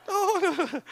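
put-on high-pitched comic Indian-accented denial. Peter denying to a young girl, high Indian accent. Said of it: "That was my no Indian, Indian accent".

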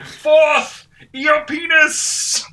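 high, loud, slow battle cry; epic wizard shout. Pretend. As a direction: bellow like a hammy wizard.

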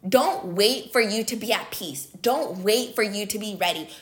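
ordinary talk, nothing marked

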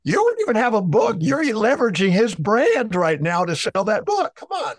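high-pitched, mock-incredulous retort; teasing incredulity. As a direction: tease them in a high, disbelieving voice. Teasing retort, retold for laughs.

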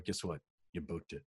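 soft, low, understated delivery; very low key. Retold or imitated. Agent telling client he booked it, very low key. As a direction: give big news with no excitement at all. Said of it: "very, very low key".

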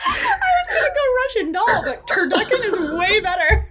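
high-pitched words breaking up with laughter; laughing. Real, not acted. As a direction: squeal it out through helpless laughs. Laughing at the turducken joke.

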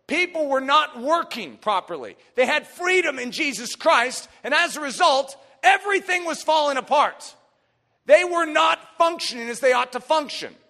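loud, high sermon delivery; full-force preaching. Real, not acted. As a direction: hammer out each failing from the pulpit.

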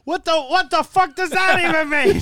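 high, loud incredulous yell; a loud high mock exasperated cry; exasperated outburst. Real, not acted. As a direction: shout it in disbelief.

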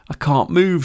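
high, frightened wail. A narrator's reading. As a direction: cry it out, paralysed with fear. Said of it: "she wailed".

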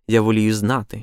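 usual speaking voice

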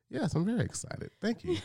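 high, sing-song mock enthusiasm; sarcastic. Husband replays his sarcastic reply to a friend, high sarcastic sing-song. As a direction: fake excitement, dripping sarcasm. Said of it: "I'm just like".

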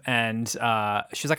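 ordinary delivery

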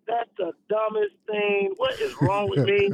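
drawn-out, retold disbelief; mock exasperation. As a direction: act out being baffled at yourself. Acts out exasperation with his own thought.

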